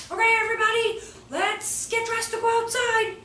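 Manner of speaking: 拖长音调，高声招呼全班; 像幼儿园老师那样高声召集孩子们; 老师大声招呼. Retold or imitated. Teacher calls class to get ready.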